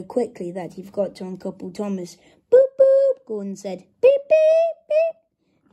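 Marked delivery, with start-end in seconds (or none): 2.5-3.2 high, loud double toot; imitated train whistle. A narrator's reading. 4.0-5.7 very high, loud train whistle imitation; whistle beeps. A narrator's reading. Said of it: "Thomas whistle-, whistled Thomas".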